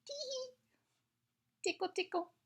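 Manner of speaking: high, soft giggly "tee hee"; playful giggle. Acted. Octopus mother tickling her children, high giggly voice.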